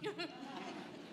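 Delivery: a quick real laugh, high and soft; breathy laugh. Real, not acted. Pastor retelling her reply, laughing softly. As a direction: break into a laugh mid-line.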